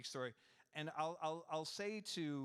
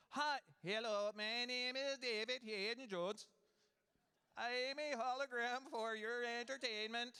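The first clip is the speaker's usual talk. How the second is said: slow, high synthetic robot voice; eighties robot voice; 8 semitones higher, 3 dB louder than usual. Acted. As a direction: speak like a stiff eighties computer. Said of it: "I'm trying to do eighties robot voice".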